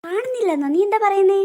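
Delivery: can't believe what she hears; shocked. Acted.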